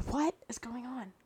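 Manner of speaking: breathy whisper to herself, lost; whispered aside. Real, not acted. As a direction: whisper it like you've lost your thread. Confused aside to herself mid-recording.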